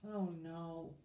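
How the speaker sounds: usual speaking voice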